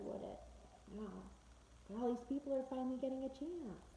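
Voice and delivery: plain reading voice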